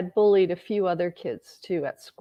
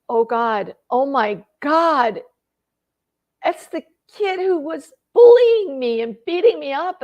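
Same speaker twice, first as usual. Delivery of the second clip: rising alarm, louder and higher as it builds; alarmed recognition; 4 semitones higher, 6 dB louder than usual. Retold or imitated. Woman recalling spotting her childhood bully, alarmed. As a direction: stretch the dismay, climbing in pitch.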